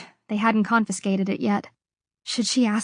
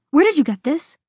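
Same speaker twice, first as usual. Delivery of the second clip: startled; 6 semitones higher, 5 dB louder than usual. A narrator's reading. Startled by found watch.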